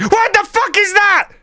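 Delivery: extremely high, incredulous yell; real outraged shriek. Real, not acted. Gamer interrupted by a disastrous play, outraged high shriek. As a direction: explode mid-sentence in disbelief. Outrage at sudden event in game.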